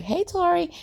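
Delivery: very high, light hello; imitated girly greeting. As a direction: mimic the girls in a high-pitched voice. Voices girls greeting their friend.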